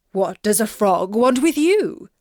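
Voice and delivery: lightly voiced king, concerned; a father asking gently. A narrator's reading. King asking his frightened daughter, lightly voiced.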